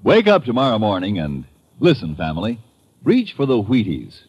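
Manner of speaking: ordinary talk, nothing marked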